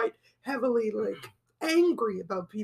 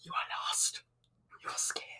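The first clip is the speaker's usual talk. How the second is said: whispering; 10 dB quieter, faster than usual. Retold or imitated. Quoting entrance theme, whispered.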